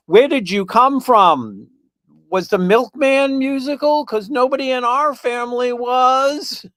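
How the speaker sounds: high, loud astonished parent voice; amazed parent. Retold or imitated. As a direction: gush like a stunned parent. Parents amazed at talented child.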